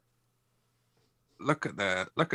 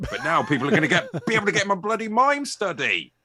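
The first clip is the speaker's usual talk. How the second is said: high, loud comic complaint; hammed-up indignant protest; mock outrage; 9 semitones higher, 5 dB louder than usual. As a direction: build to an exasperated shout, near laughter. Mock protest, running joke about study.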